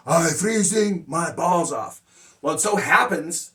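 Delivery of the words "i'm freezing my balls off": louder, slow imitation of an English learner's accent; Amigo's learner accent. Student practices new slang phrase, in learner's accent. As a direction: mimic a careful, foreign-accented learner, drawn out. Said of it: "So he practices".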